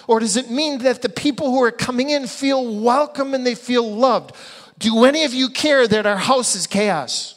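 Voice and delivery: loud, high, driving sermon voice; rising passionate preacher; fired-up preaching. Real, not acted. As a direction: preach it out with full force. Preaching at full force.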